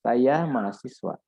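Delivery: "Saya mahasiswa" stated evenly with a falling end; statement intonation. Shown as a demonstration. Lecturer contrasting intonations for students, statement intonation. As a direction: say "Saya mahasiswa" as a simple statement, closing downward. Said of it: "intonasinya berbeda".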